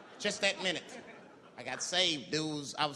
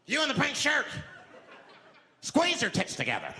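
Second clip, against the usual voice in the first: mock menacing demand; 8 semitones higher than usual. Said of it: "he starts making demands".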